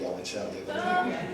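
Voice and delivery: normal talking voice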